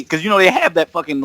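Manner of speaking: normal talking voice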